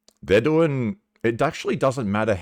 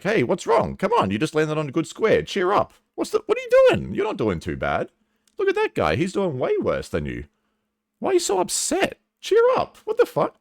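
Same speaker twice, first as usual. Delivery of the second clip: much higher, mocking cheerful nagging voice; mocking nagging people; 13 semitones higher than usual. Friends nagging a depressed player, high mocking voice. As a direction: parody pushy friends telling you to cheer up. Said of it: "people are looking at you like".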